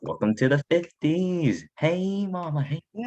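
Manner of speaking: fifties greaser voice. Acted. Fifties persona greeting.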